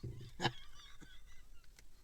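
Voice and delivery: very high, soft squeal of laughter; helpless high-pitched giggle; squeaky giggle. Real, not acted. Laughing at the pilot figure.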